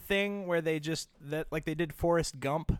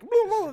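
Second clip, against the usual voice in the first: high silly whooping; 7 dB louder than usual. Pretend. Acting out flailing Kermit arms.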